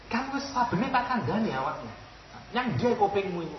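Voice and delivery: menegur. Retold or imitated. Teguran, kupingmu iku.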